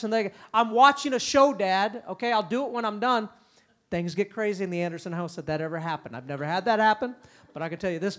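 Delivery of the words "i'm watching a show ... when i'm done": loud, brushed-off reply to dad; disrespectful kid. Retold or imitated. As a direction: blow dad off without looking up. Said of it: "with that type of voice".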